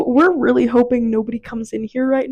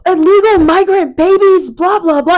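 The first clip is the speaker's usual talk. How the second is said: shouting paparazzi; 7 semitones higher, 8 dB louder than usual. Retold or imitated. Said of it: "And I was like".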